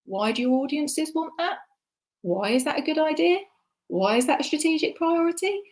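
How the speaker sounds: a hint of a challenging strategist; questioning colleagues. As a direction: ask each why with a lifting, inquisitive pitch. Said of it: "sit at the table and go".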